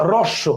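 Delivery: হ্রস্ব said as "rosso"; correct silent h. Shown as a demonstration. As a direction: begin হ্রস্ব with the r alone, no h. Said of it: "আর এর উচ্চারণ".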